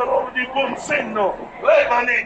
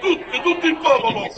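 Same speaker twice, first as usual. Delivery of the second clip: ordinary delivery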